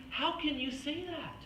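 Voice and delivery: lightly voiced frustrated God who fades back to calm. God frustrated with his doubting people, mildly exasperated. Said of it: "kind of frustrated".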